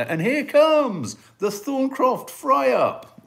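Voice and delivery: big high booming announcement, slow; grand announcer. Pretend. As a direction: announce it like a stadium MC. Announcing band name like MC.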